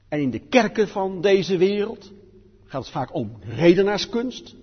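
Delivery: normal talking voice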